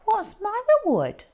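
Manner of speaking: put-on high prissy child voice, quick; goody-goody smugness; prim little brother. A narrator's reading. Peter asking Mum for a reward, high prissy voice. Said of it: "said Peter".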